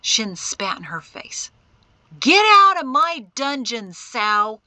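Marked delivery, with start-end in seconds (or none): 2.2-4.6 high, loud furious bellow. A narrator's reading. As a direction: bellow an order to get out. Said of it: "he bellowed".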